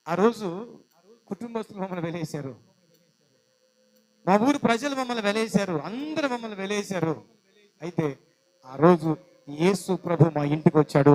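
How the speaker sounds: loud sermon proclamation; preacher loudly proclaiming the testimony; full-force preaching. Acted. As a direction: drive the testimony home.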